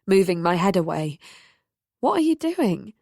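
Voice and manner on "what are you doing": high startled question; surprised. A narrator's reading. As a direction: pull back in surprise and ask. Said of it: "I said in surprise".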